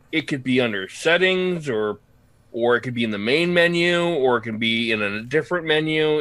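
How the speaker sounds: usual speaking voice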